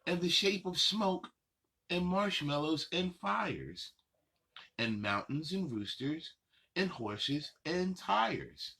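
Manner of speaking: lilting rhyme reading, slowing on the last line; reading to children. Acted. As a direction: read the list of shapes to little kids, savoring it. Reads picture book aloud.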